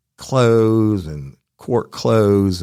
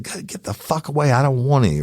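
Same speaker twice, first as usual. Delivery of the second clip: higher, irritated yell through the door; pissed-off shout; 9 semitones higher than usual. Retold or imitated. Woken from a nap, yelling at the door to leave. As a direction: bark at the knocking to go away. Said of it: "I'm pissed".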